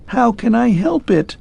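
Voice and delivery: high, despairing clockwork man; despairing plea. A narrator's reading. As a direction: implore him in despair. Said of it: "implored ... in despair".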